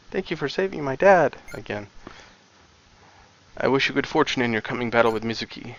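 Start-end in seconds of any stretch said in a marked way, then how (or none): 0.1-1.4 put-on child voice. Child voice repeated.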